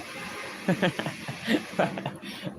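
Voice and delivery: airy unvoiced giggle at her own joke; breathy laugh. Real, not acted. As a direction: let a soft breathy laugh escape. Laughs after paisa greeting.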